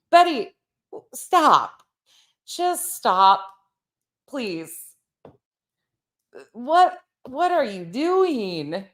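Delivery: high, drawn-out pleading; exasperated plea. Acted. Pleading with Betty to stop, mock exasperated. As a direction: beg her to stop, half laughing.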